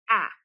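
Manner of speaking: "ah" as a short a, as in "hat"; one short vowel on "ah"; short A sound. Shown as a demonstration. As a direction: model "ah" as what the marked A says. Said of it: "that A is gonna".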